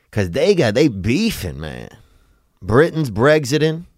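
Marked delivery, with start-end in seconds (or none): none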